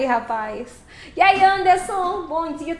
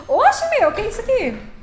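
surprised; 6 semitones higher, 4 dB louder than usual. Real, not acted. Truck game surprise.

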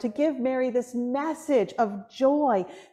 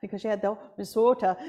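put-on Minnesota accent; 3 semitones lower, much faster than usual. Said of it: "Duluth, Minnesota".

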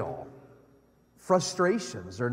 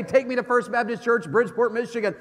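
talking to phone; 7 semitones higher than usual. Retold or imitated. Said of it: "I just pull my phone and say".

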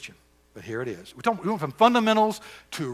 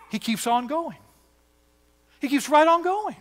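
higher and higher, triumphant; rising excitement; 12 semitones higher than usual. Acted. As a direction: lift it to a joyful peak. Preacher's climax.

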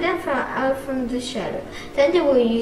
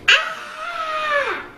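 short high shriek of fright; high scream. Acted. Nuns screaming on seeing the spiders, high shriek. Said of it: "they screamed".

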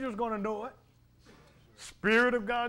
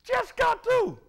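high, loud preaching cry; preaching shout; 12 semitones higher, 6 dB louder than usual. Acted. Preacher crying out the servant's fall to the congregation, loud and high. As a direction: cry it out from the pulpit.

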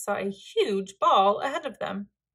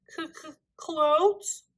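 higher, stuttering question; nervous stammer; 6 semitones higher than usual. A narrator's reading. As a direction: stammer the first sound in alarm. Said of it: "stammered Lars".

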